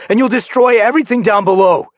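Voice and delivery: high, loud shout. Acted. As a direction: yell at him to stop. Shouting warning.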